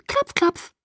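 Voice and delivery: high, playful sing-out with a grin; teasing little announcement, pitched high; grinning. A narrator's reading. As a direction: say it through a cheeky grin. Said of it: "sagte sie grinsend".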